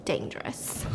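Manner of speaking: breathy joking whisper; whispered punchline. Real, not acted. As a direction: whisper it like a secret.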